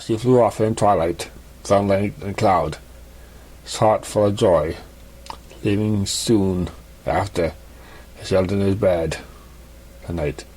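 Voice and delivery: ordinary delivery, nothing marked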